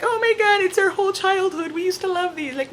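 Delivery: phoning brother, high excited gush; excited squeal. Retold or imitated. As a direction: gush like a kid again.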